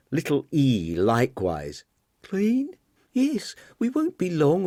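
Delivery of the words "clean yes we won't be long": put-on young woman voice. Marian hurrying to leave.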